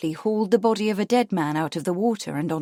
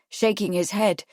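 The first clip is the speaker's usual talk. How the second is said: ordinary delivery, nothing marked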